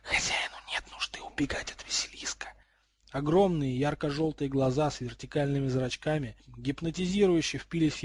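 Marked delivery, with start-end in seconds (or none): 0.0-2.5 eerie unvoiced snake whisper; hissing three heads. A narrator's reading. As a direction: hiss it like three snakes at once. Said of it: "шипели все три головы одновременно".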